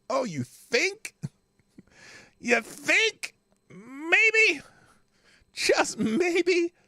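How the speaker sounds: high, drawn-out mocking oh you think; sarcastic disbelief, pitch way up. Real, not acted. Sarcastic reaction to news text.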